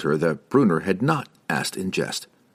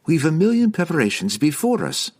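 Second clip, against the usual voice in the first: high, insistently upbeat voice; stubbornly cheerful; 10 semitones higher than usual. A narrator's reading. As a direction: keep it cheerful, whatever he says. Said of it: "stubbornly holding her cheerful tone".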